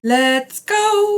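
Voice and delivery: excited. Real, not acted. Cheering as the video starts.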